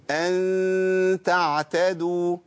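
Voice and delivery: أن تعتدوا slow, nasal ghunna held long, lips in a slight smile; light nasal ghunna with a smile. Shown as a demonstration. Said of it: "مع المرقق محتاج إن أنا أعمل ابتسامة بسيطة".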